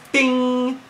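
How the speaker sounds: a sharp, high-pitched cartoon bing; ping it out like a bell being struck; a bright little ding as the flower lands; high bing sound effect. Mimics flower hitting the letter.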